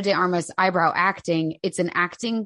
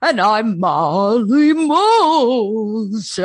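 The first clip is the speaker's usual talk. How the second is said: slow, louder ghostly moan; spooky ghost voice; 5 dB louder, much slower than usual. Acted. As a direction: answer as a second ghost. Joins the ghost voice intro.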